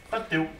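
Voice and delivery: Tateu said low and softly with a T for M; low, soft "Tateu", lisping substitution; imitated speech impediment. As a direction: quietly imitate a boy saying "Tateu" for Mateus. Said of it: "os meninos chamam de Tateu".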